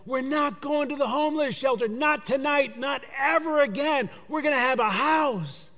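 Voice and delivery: high, bright voice of a mother bursting with news; joyful announcement. Retold or imitated. Angel phoning her kids about the new house, overjoyed. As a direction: sing it out to the kids. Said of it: "she says".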